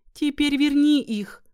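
high, loud pleading plea. Acted. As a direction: beg the goddess. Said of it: "попросила она богиню".

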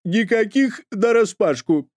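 high, slow, loud flat refusal; high slow stern nanny; categorical nanny. A narrator's reading. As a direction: forbid it outright, slowly. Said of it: "категорично заявила Маргарита Егоровна".

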